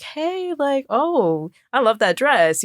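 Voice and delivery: high-pitched, gushing compliment; acted-out chirpy hello, pitch leaping up then easing down; friendly gushing greeting. Greeting someone at a networking event, gushing.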